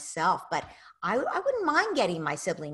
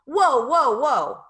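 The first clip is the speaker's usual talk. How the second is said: loud, higher whoa to break up a fight; calling out to stop; 6 semitones higher, 9 dB louder, much slower than usual. Shown as a demonstration. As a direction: shout it out to grab their attention. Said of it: "you get between them".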